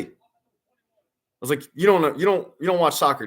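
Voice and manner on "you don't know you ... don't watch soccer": raised, incredulous scolding; mock scolding. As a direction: tease him for not knowing. Retelling shaming the former player.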